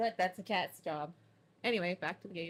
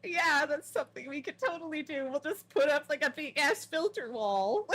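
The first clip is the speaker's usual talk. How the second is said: higher, louder mock agreement; sarcastic retort; 8 semitones higher, 7 dB louder than usual. Answering the politicians with loud, high sarcasm. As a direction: sell the absurd idea with exaggerated sarcasm. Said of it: "I was like".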